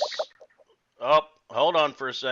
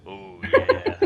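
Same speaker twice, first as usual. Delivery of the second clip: Wolfman Jack voice; 4 dB louder than usual. Shown as a demonstration.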